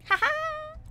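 high, sharp mock laugh; mock cackle. Vtuber cackling while showing toggles. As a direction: cackle like a cartoon villain.

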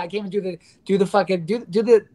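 ordinary talk, nothing marked

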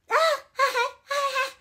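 a squealing giggle; high laughing burst. Real, not acted. Viewer laughing at the awkward neighbor, high giggle.

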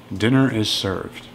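usual speaking voice

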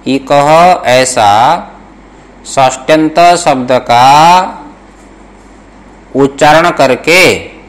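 slow, measured dictation to students. Shown as a demonstration. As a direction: spell out each word slowly for note-taking. (धीमे-धीमे श्रुतलेख लिखवाते हुए)

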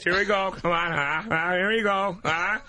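a hammed-up Ray Romano in bed; Ray Romano impression. Comedian in studio, mock Ray Romano having sex. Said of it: "Ray Romano having sex".

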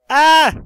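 sudden loud high yelp; startled yelp. Real, not acted. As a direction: jump at the screen with a cry. Reacting to the roast video.

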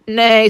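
loud horse neigh; whinnying horse call. A narrator's reading. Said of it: "said the horse".

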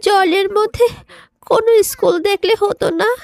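a little fish whining that it can't breathe; pleading fish child. Acted.

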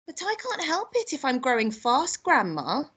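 put-on high little-boy protest.

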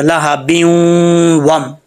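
long nasal ब्यूं gliding into वम; merged reading. Shown as a demonstration. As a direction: hold the hum of ब्यूं before the w. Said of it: "ऐसा पढ़ा जाएगा". (ब्यूं को गुन्ने से मिलाएँ)

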